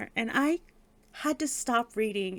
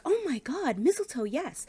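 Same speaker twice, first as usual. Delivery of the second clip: high, swooping enthusiasm; excited gasp of delight; 6 semitones higher than usual. Retold or imitated. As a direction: squeal the idea like an eager friend. Acting out Camilla's delighted reply.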